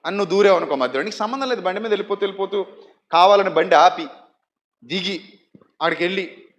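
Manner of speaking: normal talking voice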